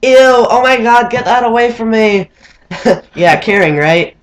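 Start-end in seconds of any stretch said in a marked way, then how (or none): 0.0-2.3 disgusted grandma. Retold or imitated. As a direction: recoil in disgust like a squeamish grandma. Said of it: "she proceeded to say".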